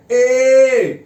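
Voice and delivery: ए stretched long and said loud; long vowel ए. Shown as a demonstration. Teacher demonstrating the long ए to students, stretched and loud. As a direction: hold the ए out long and strong. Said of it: "और ये long वाला ए है".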